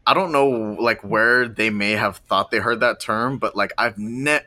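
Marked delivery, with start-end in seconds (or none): none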